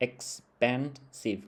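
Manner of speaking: "expensive" slowly split into ex-pen-sive; syllable by syllable. Shown as a demonstration. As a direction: break "expensive" into three separate beats, ex, pen, sive. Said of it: "มีสามพยางค์".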